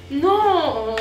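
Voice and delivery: loud high cry of disgust; shocked no. Real, not acted. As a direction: yelp it out in dismay. Sees the kebab sauce float.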